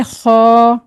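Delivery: खौ drawn out, thick and full; heavy kho. Shown as a demonstration. Teacher sums up how to read it, heavy खौ. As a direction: say खौ from the throat with an o colour. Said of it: "तो इसको पढ़ेंगे".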